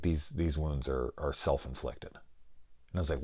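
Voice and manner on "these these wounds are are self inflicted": lightly acted low, soft aside; confidential doctor. Doctor pulled him aside, imitated quiet, confidential tone.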